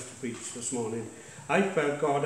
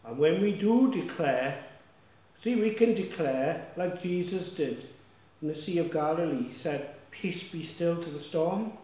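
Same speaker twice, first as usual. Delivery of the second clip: punchy pulpit declaration; preaching; 4 semitones higher than usual. Acted. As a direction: exhort the church, pushing key words. Pastor exhorts congregation from the front.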